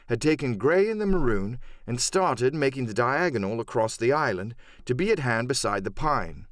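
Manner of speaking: plain reading voice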